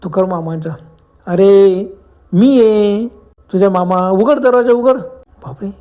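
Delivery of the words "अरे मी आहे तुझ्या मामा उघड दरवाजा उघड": louder drawn-out call through a door; imitated uncle, insistent to be let in; uncle calling from outside. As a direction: play the uncle announcing himself loudly. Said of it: "बाहेरून आवाज आला तुकाराम मामांचा".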